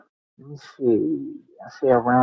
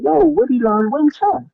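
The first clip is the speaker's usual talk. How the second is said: much higher, keen ask; eager question; 11 semitones higher than usual. Retold or imitated. Asking the man where his cousin trained, eager. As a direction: fire the question, hungry to know. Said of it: "I said".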